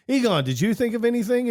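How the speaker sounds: high-pitched frantic question; mock frantic question. Quizzing Egon in a retold scene, high frantic mock question. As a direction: squeak out the question in a panic.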